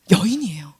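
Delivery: hushed, unvoiced whisper. Real, not acted. Preacher answering his own question to the children, whispered. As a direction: let the answer out as a secret.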